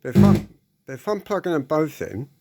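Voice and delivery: ordinary talk, nothing marked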